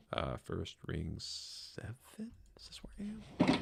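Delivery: whispered, to herself; whispering. Real, not acted. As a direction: breathe the words under your breath. Unvoiced muttering to self.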